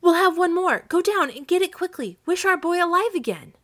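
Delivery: high, loud exultant commands; triumphant cry. A narrator's reading. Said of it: "she cried triumphantly".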